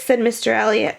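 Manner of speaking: ordinary delivery, nothing marked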